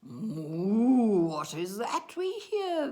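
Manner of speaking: swooping, drawn-out moo into words; mooing cows. A narrator's reading. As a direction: moo the question like a cow. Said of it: "the cows were with their calf".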